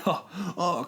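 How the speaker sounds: laid-back, high surfer drawl; surfer dude voice. Pretend. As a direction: play a chill beach surfer. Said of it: "I don't like your surfer voice".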